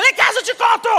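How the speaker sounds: gritando com o marido. Retold or imitated. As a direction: shout him down. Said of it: "Tô gritando com ele". (gritando irritada com o marido)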